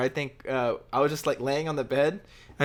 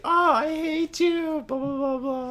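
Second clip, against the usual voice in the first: high, slow, whiny mock complaint; annoyed girlfriend; 13 semitones higher, slower than usual. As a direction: drag it out like a fed-up girlfriend. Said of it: "And she's like".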